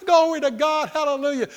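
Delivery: high, loud jubilant shouting, easing off; mock-laughing hallelujahs at high pitch; exultant praise. Shown as a demonstration. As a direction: cry out praise as if overjoyed. Demo of praising while fake laughing.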